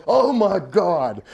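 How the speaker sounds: high, slow, stretched-out wail; hammed-up cry of dismay; mock horror. Tall dude finding another victim, wailing in mock horror.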